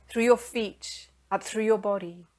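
ordinary delivery

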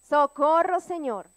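grita socorro alto, como pidiendo auxilio; un clamor fuerte al Señor. Retold or imitated. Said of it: "¿Qué fue lo que dijo?". (clamor fuerte de auxilio)

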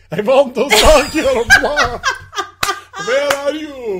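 mock Russian vampire. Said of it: "sounding like a Russian vampire".

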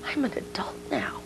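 high, whispery amazement; hushed wonderment. Retold or imitated. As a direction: marvel at it under your breath, as the daughter. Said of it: "saying to me with wonderment".

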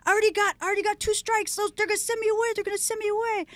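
high, frantic begging voice; pleading inmate. Retold or imitated. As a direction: imitate an inmate desperate not to be reported. Inmate begging not to be reported.

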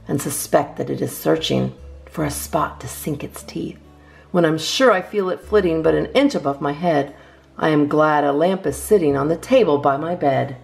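ordinary delivery

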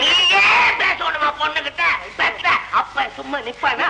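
high, protesting shout; indignant shout. Acted. Father demanding his turn with the daughter, indignant shout. Father jealously breaks into reunion.

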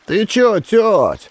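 high, panicky cry; frightened yell. A narrator's reading. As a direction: yelp it and back off. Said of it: "в страхе заорал масластый подросток". (подросток в страхе вскрикивает)

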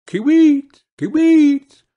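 high, loud chirping bird cry; bird call. Acted. The bird announcing itself from the roof, high and loud. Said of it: "began to sing".

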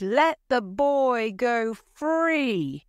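high, slow, drawn-out cry; long pleading cry from an old man; old man's cry. A narrator's reading. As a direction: call it out high, stretching the words. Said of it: "he cried".

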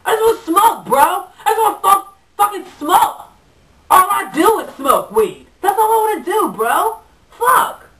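high, loud, whining outburst; worked-up stoner rant. Acted. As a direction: protest loudly as an aggrieved stoner bro. Chad ranting about his weed.

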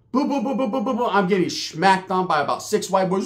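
usual speaking voice

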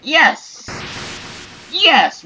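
triumphant cheer. Real, not acted. Barrier destroyed, player cheers.

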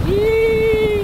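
high, gleeful whoop; excited yell. Real, not acted. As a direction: let out a joyful yell. Cheering while skiing fast.

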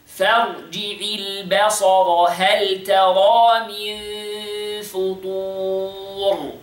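measured tajweed recitation with long vowels; Quran recitation. Acted. Teacher reciting the verse for students to copy. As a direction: recite it like the teacher's model reading.

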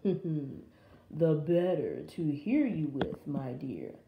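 low, slow wolf voice; low wolf posing as Granny; sly wolf. Acted. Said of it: "said the wolf".

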